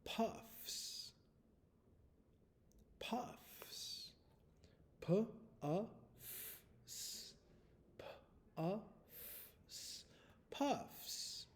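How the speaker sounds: puffs and its spelling breathed softly; whispered dictation. Shown as a demonstration. Teacher whispering the spelling word puffs. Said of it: "the word is".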